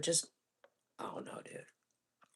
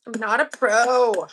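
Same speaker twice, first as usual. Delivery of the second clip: loud; 5 semitones higher, 11 dB louder, slower than usual. Real, not acted. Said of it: "I'm kinda bad at this".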